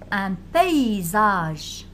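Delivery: « paysage » lent, pé-i-zage, syllabes détachées; découpe « paysage » lentement. Shown as a demonstration. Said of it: "A Y se prononce E I". (« paysage » lentement : pé-i-zage)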